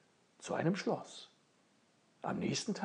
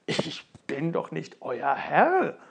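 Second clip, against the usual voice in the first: langsam und verwundert, „Herr“ gedehnt; ungläubig abwehrend, langsam; verdutzt; 9 dB louder, slower than usual. Acted. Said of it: "ganz verdutzt und antwortete". (Ofenmichel antwortet den Zwergen verdutzt, langsam)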